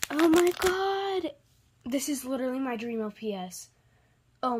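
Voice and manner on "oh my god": excited. Real, not acted. Still amazed by toy.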